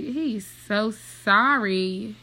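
slow, loud and drawn out; mock pity.

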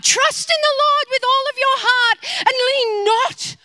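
high, loud, near tears, quoting scripture at God; praying woman hurls scripture at God, loud and tearful; impassioned quoting. Retold or imitated. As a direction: fling the verse back at heaven. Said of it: "we love to quote scripture back to God".

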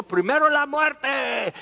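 mock-melodramatic "over my dead body"; defiant refusal.

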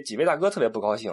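normal talking voice

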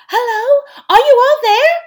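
ordinary delivery, nothing marked

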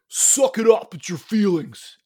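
loud gruff parent voice; tough-love dad. Retold or imitated. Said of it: "you would have been like".

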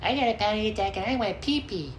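mock them in a babyish voice; mocking people who overshare panic attacks; mocking whiner. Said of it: "what people tell me".

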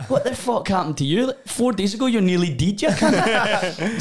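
high, incredulous telling-off with a laugh; mock outrage. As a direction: act stunned at your blotto brother. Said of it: "And I'm like".

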